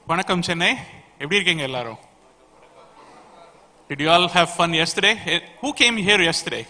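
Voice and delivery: rally the room with energy; excited crowd greeting. Real, not acted. Host greets the crowd warmly.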